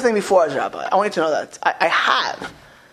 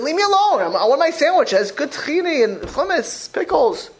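annoyed sandwich eater; 7 semitones higher than usual. Retold or imitated. A person defending their sandwich, annoyed and raised. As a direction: push the rebuker away, protective of lunch. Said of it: "They said".

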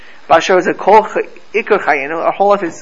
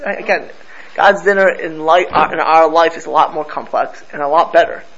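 ordinary talk

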